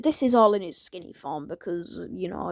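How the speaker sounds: ordinary talk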